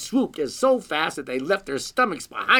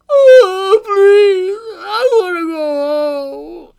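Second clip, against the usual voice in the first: loud, high, drawn-out sobbing wail; crying little girl; 14 dB louder, much slower than usual. A narrator's reading. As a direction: bawl it like a homesick small child. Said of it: "started to cry and said".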